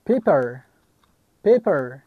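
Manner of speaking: "پیپر" with ay in the first syllable. Shown as a demonstration. Teacher giving an example of A as ay, "پیپر" said paper. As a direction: model "پیپر" as English paper, each take apart.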